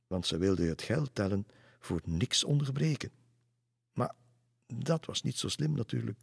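usual speaking voice

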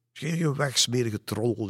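high, loud chasing-off shout; angry shout. Acted. Roel shouts the jester away, loud and angry. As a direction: drive him away with a yell. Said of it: "riep Roel".

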